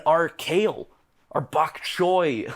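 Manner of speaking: high, loud outrage over each vegetable; worked-up rant. Acted. Conspiracy theorist listing poisoned vegetables, worked-up and loud.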